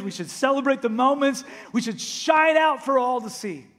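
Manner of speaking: loud, high, urgent sermon delivery; full-force preaching. Acted. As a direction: preach it out to the whole room. Pastor preaching to congregation.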